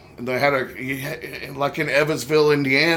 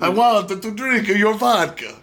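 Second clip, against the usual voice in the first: higher, hammed-up Russian accent; Russian-vampire accent; 6 semitones higher than usual. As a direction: do a cartoon Russian, half vampire. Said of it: "almost like a vampire".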